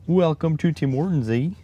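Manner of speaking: high-pitched backwoods Canadian accent; very rural Canadian accent. Shown as a demonstration. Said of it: "a very rural accent".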